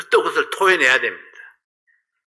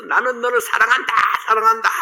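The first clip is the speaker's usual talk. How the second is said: echo; 9 semitones higher than usual. Retold or imitated. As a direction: let it ring back from every side. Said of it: "하면서 메아리가 막".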